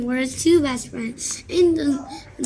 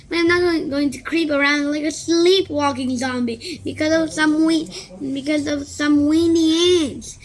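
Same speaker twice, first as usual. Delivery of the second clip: loud, higher brash boast; defiant; 4 semitones higher, 6 dB louder than usual. Acted. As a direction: brag you're not scared.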